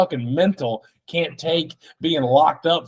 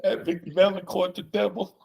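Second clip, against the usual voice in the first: put-on voice; 7 semitones higher, 6 dB quieter than usual. Odd put-on voice.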